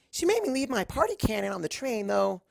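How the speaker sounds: very high, loud bubbly pout; bouncy, whiny party pony; a bubbly pony complaining about her party cannon; Pinkie Pie voice. Acted.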